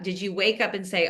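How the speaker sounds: ordinary delivery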